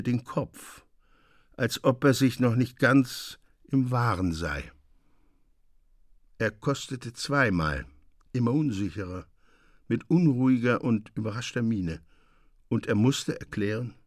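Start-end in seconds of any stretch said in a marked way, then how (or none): none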